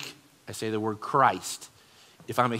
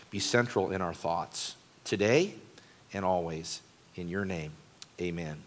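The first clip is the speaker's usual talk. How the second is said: soft, earnest prayer voice, trailing quieter; 5 semitones lower, 4 dB quieter than usual. Acted. Pastor praying to the Lord before preaching, soft and earnest. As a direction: pray humbly before the sermon.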